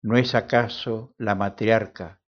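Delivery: normal talking voice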